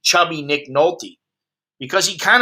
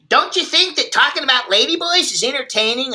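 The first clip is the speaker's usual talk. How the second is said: higher put-on voice quoting his buddy; imitating a friend; 9 semitones higher than usual. Quoting Chuck after the stream, higher mock voice. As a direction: mimic the friend, pitched up and teasing. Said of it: "He told me after the stream".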